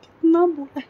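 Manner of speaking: high, loud, cracking cry of real grief; voice breaking with grief. Real, not acted. Recalling her dead mother, a daughter breaks down in real tears.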